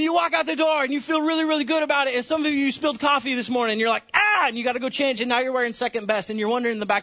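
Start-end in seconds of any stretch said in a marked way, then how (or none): 4.1-4.5 mock dismay cry. Spilled coffee, mock dismay.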